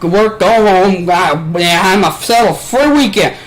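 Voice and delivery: put-on crank the Southern accent up to full bluster; loud mock Southern ranting; aggressive Southern accent. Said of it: "then just, he got aggressively Southern".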